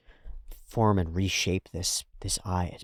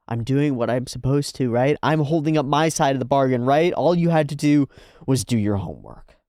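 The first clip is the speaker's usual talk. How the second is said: higher and louder, pleading and annoyed; exasperated pleading rant; indignant plea; 5 semitones higher, 5 dB louder than usual. Retold or imitated. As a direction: push back hard, defending yourself. Acted pleading argument with Joe.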